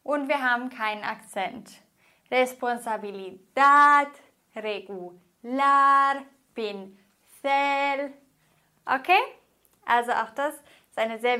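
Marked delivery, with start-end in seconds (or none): 2.3-4.1 Responsabilidad with the stress clearly on -dad; Responsabilidad, last syllable punched. Shown as a demonstration. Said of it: "Die Betonung ist auf der letzten Silbe". 4.5-6.2 very slow regular, stressed on -lar; stress on last syllable. Shown as a demonstration. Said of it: "Die Betonung ist auf der letzten Silbe". 6.5-8.1 pincel with the stress on -cel; pincel, last syllable stressed. Shown as a demonstration. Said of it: "Die Betonung ist auf der letzten Silbe".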